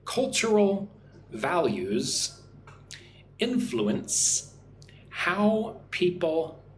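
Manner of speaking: slow, careful model reading with pauses between words; nice and slow. Shown as a demonstration. Teacher reading for English learners in Vietnam, nice and slow. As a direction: read it word by word for repeating. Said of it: "nice and slow".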